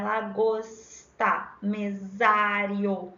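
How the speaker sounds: "Lagosta, mesário" stretched very slowly, sound by sound; literacy-class sounding-out. Shown as a demonstration. Teacher in literacy class, "Lagosta, mesário" slowly sounded out for pupils. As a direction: say "Lagosta, mesário" drawing out each phoneme. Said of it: "a gente fala dessa forma".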